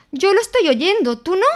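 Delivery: ask, nervously close to the thing; anxious. A narrator's reading. Piglet to Christopher Robin near the trap, anxious. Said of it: "dijo Porquet ansiosamente".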